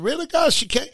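asking God in disbelief; surprised question. Retold or imitated. Husband asking God about his sick wife, surprised. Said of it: "I said".

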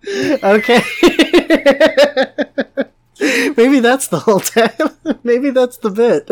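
high, loud, laughing through words; can't stop laughing. Real, not acted.

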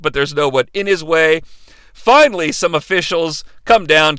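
ordinary delivery

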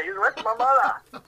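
high and slowed, laughing as he fixes the word; giggling it out. Real, not acted. Son fixing his joke to the pub crowd, laughing.

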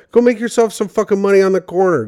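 loud, high, fast mock rant; mock yelling. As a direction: bark it out like a pimp yelling orders. Mock rant telling her to hustle.

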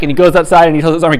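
normal talking voice

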